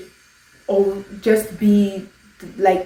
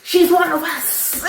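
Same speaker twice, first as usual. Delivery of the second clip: mock commenter; 8 semitones higher, slower than usual. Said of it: "you would actually be like".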